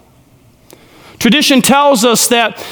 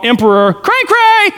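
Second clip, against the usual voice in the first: high, silly put-on voice for the nickname; high goofy teasing voice; mocking nickname; 9 semitones higher than usual. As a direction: poke fun at the mad emperor. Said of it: "as I like to call him".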